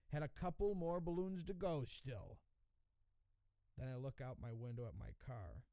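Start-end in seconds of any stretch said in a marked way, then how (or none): none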